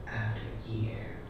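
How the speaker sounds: fading whispered last echo; soft whispered refrain. Acted. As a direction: breathe the final repeat away. Poem's repeated refrain fading out.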